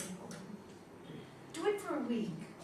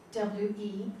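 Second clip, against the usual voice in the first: normal talking voice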